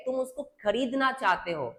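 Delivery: usual speaking voice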